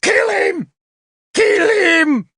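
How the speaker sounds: very high, frenzied shriek; screamed. A narrator's reading. Tanabers recognising the enemy in the hall, shrill scream. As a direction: shriek the order in alarm. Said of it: "and screamed".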